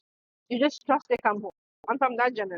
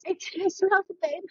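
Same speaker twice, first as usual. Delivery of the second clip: higher, fast gushing bestie voice; a friend hyping up her dumped bestie, higher and gushing; mock hype bestie; 6 semitones higher, faster than usual. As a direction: gush like a cheerleading bestie. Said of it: "young women hype for the young women".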